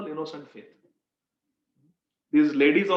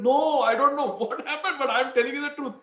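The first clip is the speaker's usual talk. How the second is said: high-pitched, pleading mock wail; crying out in protest; 7 semitones higher than usual. Condemned merchant pleading with the king, crying out in imitation. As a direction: protest desperately, voice pushed high. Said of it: "the merchant starts crying".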